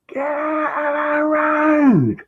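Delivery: very high, drawn-out mock screech; imitated shouting. Masseur yelling at him to leave the road, mock shouting. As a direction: stretch it into a shrill, high-pitched yell.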